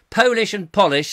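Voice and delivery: "Polish and polish" as POE-lish, then POLL-ish; long O in "Polish", short O in "polish"; contrasting two pronunciations. Shown as a demonstration. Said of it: "spelt the same. Two different meanings".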